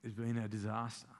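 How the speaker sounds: hushed, low and hesitant; soft, grave, with a long pause; breaking bad news. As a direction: play an employee gently breaking terrible news. Employee breaks terrible news to boss.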